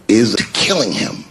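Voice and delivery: normal talking voice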